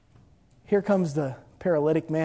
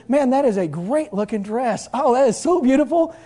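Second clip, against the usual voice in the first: blind man admiring dress; 7 semitones higher, 5 dB louder than usual. Acted. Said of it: "the blind guy looks at her and says".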